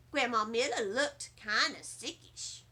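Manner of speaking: usual speaking voice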